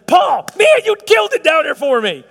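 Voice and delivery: high, loud, overjoyed welcome; Jesus. Pretend. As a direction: cheer the new arrival into heaven. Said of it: "do you wanna hear Jesus say".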